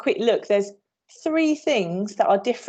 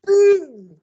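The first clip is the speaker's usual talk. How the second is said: high, loud mock cannon blast; playful shouted cannon boom; 10 semitones higher, 9 dB louder than usual. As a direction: fire off the cannonball with a big boom. Imitating cannonball in pirate game.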